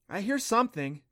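a hint of a girl listening hard; lightly voiced girl. A narrator's reading. Clancy to Adam, a lightly voiced unsure girl. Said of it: "Clancy replied, stopping".

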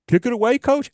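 can't believe the order, ask back; surprised player. Acted. Player questioning coach's order.

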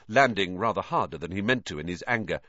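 usual speaking voice

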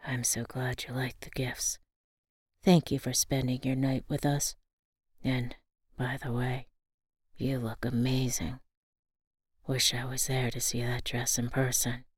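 soft, low and hushed; tender whisper. A narrator's reading. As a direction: murmur it lovingly. Father speaks lovingly, softly.

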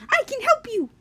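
very high squeaky little creature; high-pitched cartoon branch character; talking branch. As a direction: offer help in a tiny squeaky voice. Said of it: "there's one branch who's like".

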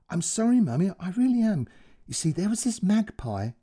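put-on a little girl chattering her apology. Child apologising on the phone.

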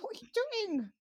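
shocked mum half-shouting; startled question. Retold or imitated. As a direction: ask it still jumpy from the fright. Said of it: "I'm like".

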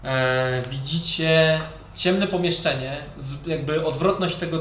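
ordinary delivery

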